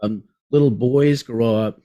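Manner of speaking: ordinary delivery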